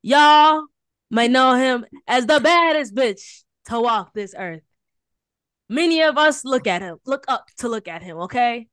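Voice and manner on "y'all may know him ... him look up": louder, slower announcer build-up; big showy intro shout; hype-man shout. Real, not acted. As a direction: bring him on like a star.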